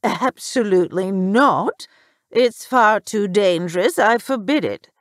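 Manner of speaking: loud, haughty mother's refusal; stern forbidding. A narrator's reading. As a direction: forbid it emphatically, every word weighted. Mother forbids her daughter to go.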